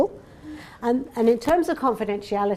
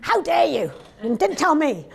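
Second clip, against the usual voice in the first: high, loud outraged parent on the phone; angry parent; 7 semitones higher, 4 dB louder than usual. Retold or imitated. Complaining parent rings the clinic, high loud outrage. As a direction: ring up furious, how dare you. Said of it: "dealt with all the complaints".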